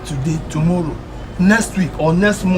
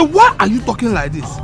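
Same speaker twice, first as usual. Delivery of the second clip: pointedly repeated, raised-voice question; insistent repeat; 2 semitones lower, 4 dB quieter than usual. Acted. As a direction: press the question, demanding an answer.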